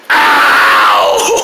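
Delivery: high, loud cry of pain; loud yelp of pain. Acted. Hurting his chest, he yells in pain. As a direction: yelp as your chest is hurt.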